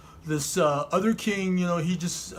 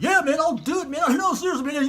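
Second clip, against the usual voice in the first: high, excited dude voice; pitched-up earnest hype; eager insistence; 10 semitones higher than usual. Acted. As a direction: insist you really will, all worked up. David insisting he will fight.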